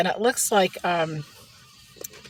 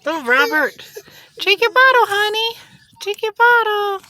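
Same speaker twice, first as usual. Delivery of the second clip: much higher, loud sing-song coax; 13 semitones higher, 6 dB louder than usual. Real, not acted. Mother coaxing baby Robert to drink his bottle. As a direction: coo him into drinking.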